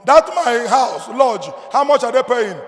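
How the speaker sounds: loud, slow, drawn-out boast; father showing off what his son pays for; boastful father. Retold or imitated. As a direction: stretch each word, proud and pointed. Father boasting about son's money.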